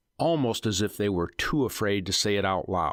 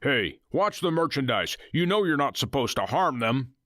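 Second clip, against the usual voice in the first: Nathan barking; 6 semitones higher than usual. Acted. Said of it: "Nathan barked".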